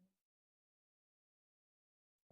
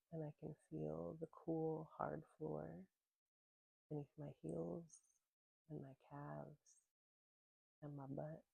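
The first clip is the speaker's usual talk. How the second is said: calm, unhurried voice with long pauses; gentle grounding-practice guide; guided meditation; 5 dB quieter than usual. Real, not acted. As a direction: ease listeners into their senses, letting phrases settle and fade.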